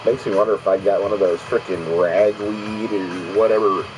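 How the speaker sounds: ordinary talk, nothing marked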